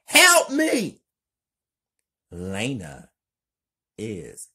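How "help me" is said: very high, loud desperate scream; yelling for help. Acted. Kelly fleeing Lena's spiders, desperate high scream. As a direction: scream for help while running. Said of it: "Kelly yells".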